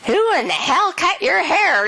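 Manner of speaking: high, drawn-out indignant demand; retold indignation, pitched well up; pissed off. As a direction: re-enact an outraged question, slow and high. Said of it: "I was pissed".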